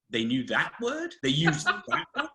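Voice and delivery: gasp, then incredulous high-pitched question; mock-shocked, voice leaping up at the end; acted surprise. Reader reacting to old words in Chaucer, mock astonished. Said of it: "we were surprised at what".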